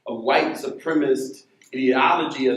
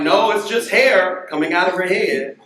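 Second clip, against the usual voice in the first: exasperated mom; 5 semitones higher, 7 dB louder than usual. Retold or imitated. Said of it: "I was like".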